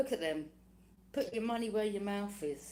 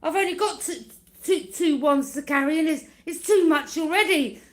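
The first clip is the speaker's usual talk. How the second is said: loud, higher hammed-up complaining; mock exhausted moan; 7 semitones higher, 13 dB louder than usual. Mimicking enemies exhausted carrying burdens uphill, hammed-up moan. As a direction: whine like someone worn out on a hill.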